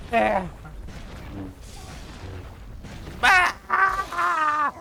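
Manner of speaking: high, very loud screams; panicked screams. Real, not acted. Player being beaten on, high loud screams. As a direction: scream out under attack.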